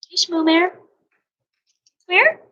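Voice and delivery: high-pitched little call to a loved one; affectionate calling. Real, not acted. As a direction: lift the voice up high, rising on the question. Calling to a pet or child.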